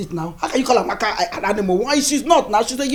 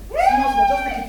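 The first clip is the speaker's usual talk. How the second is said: much higher, gentle coo; high soothing coo; 4 dB louder than usual. Acted.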